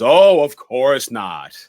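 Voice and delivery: loud, slow, drawn-out sarcasm; dripping sarcastic retort in a retold quote. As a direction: stretch it out with heavy mock agreement. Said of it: "Sarcastic ass Loomis goes".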